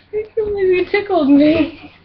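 high, breathless after tickling; being tickled. Real, not acted. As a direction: still giggly from tickling. Just tickled, laughing high.

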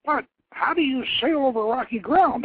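put-on eager young prince. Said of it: "Akat asked".

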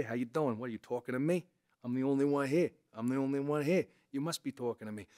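lightly voiced De Niro up in the head; a hint of a nasal-placed young tough guy. Shown as a demonstration. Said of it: "It's up here".

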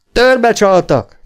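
high, loud, slow cry on törbe; excited shout. A narrator's reading. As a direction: yell "Törbe csaltak" in agitation. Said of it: "kiáltotta izgatottan Gunnar".

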